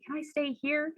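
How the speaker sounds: high, soft imagined crush asking. Acted. Imagined crush at the door asking mom, high and soft. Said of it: "for her to say".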